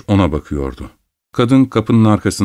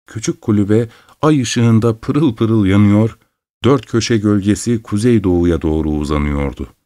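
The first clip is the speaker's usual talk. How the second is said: usual speaking voice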